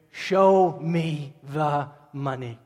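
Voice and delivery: slow, drawn-out movie catchphrase; Cuba Gooding Junior. Acted. Preacher quotes a film star to the congregation, drawn-out catchphrase. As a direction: stretch out each word of the famous line. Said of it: "Cuba Gooding Junior".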